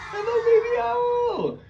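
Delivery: very high, slow, squealed greeting; Baby Owl voice. Shown as a demonstration. Teacher greeting class as Baby Owl, very high. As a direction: greet like a tiny owl chick. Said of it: "say hello to Baby Owl".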